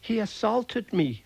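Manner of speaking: high squeaky voice. A narrator's reading. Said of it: "squeaked the morsel".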